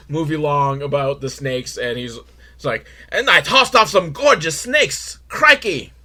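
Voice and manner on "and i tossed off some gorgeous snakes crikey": excitable, higher mock Australian accent; hammed-up Australian accent with a punchy finish; Steve Irwin. Reviewer making fun of the wildlife host, mock Australian accent. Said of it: "I'm making fun of him".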